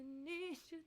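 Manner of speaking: usual speaking voice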